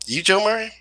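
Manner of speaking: high, loud disbelieving question; mock the teller's surprise at the mismatch; doubtful bank teller asking; surprised teller. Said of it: "And she went".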